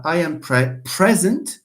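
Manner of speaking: front-stressed "present"; stress on first syllable. Shown as a demonstration. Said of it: "because present is not a verb".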